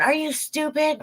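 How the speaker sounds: high-pitched mock snap at a fallen kid; scolding a child who fell, in a high mock yell. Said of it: "you're not yelling at 'em going".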